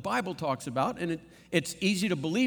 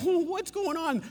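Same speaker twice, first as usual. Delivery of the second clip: amazed crowd; 11 semitones higher than usual. Acted.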